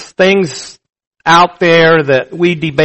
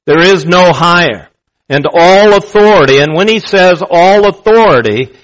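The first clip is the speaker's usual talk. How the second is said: normal talking voice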